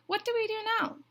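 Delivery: very high, loud duck voice; questioning duck. A narrator's reading. Duck asks Goose for a plan, high-pitched duck. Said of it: "asked the duck".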